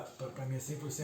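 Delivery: ordinary talk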